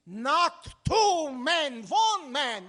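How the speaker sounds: slow, loud, spelled-out correction; exasperated shout. Acted. Tenant yells down the phone at the bank clerk, exasperated. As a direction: hammer each word at the deaf listener.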